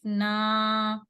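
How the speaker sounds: "ना" held long on a level note; stretched naa. Shown as a demonstration. Teacher spelling out noon zabar alif, long naa. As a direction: sustain the aa of "ना" for two counts. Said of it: "लंबा कितना करना है".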